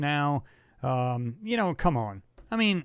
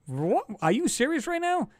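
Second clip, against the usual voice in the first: shocked disbelief; 11 semitones higher than usual. Retold or imitated. Friend shocked it's a NASA photo.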